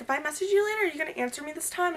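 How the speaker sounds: high, loud, fast challenge; challenging. Retold or imitated. Demanding Milan answer her messages, high loud challenge.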